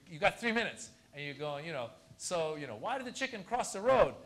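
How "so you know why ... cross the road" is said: setup rising into a loud punchline; stage joke delivery. Acted. New comic telling a joke in a coffee shop, projecting loudly. Acts comic onstage straining to be heard.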